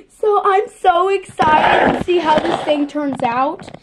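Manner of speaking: loud, bursting with excitement; nearly shouting with joy; real excitement. Real, not acted.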